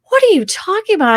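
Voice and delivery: high mock-incredulous husband voice; husband's disbelief. Husband asking wife about her story, incredulous.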